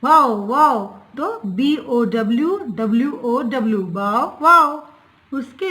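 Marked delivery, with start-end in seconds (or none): 0.0-0.8 louder playful "वाओ, वाओ" bark; a teacher's louder playful bark; dog bark imitation. Shown as a demonstration. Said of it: "dog कैसे बोलता है". 3.9-4.9 louder playful "वाओ, वाओ" bark; a teacher's louder playful bark; dog bark imitation. Shown as a demonstration. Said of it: "dog कैसे बोलता है".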